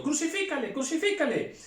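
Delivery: very high shrieked demand; crowd cries. Acted. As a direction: cry it out as the mob. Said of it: "volvieron a dar voces diciendo". (gritos de la turba)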